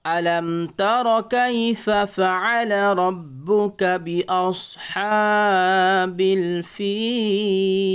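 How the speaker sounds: slow, drawn-out Quranic chanting of بأصحاب الفيل; melodic recitation. Shown as a demonstration. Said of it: "আরেকবার পড়েন".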